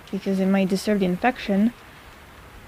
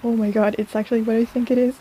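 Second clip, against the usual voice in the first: usual speaking voice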